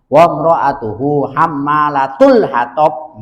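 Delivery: "Wa mra'atuhu hammalatul hatab" recited very slowly with -tul; slow Quranic chant of "hammalatul hatab"; alternate reading. Shown as a demonstration. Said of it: "bisa dibaca hammalatu".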